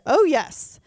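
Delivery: lightly mocking the British CEO's accent. British CEO brightly acknowledging the pregnancy, in a put-on British accent. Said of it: "I'll use my horrible British accent here".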